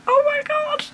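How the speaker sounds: squealed high, mock girly; girly oh my god. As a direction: squeal it like a giddy girl. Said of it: "that really girly thing of going".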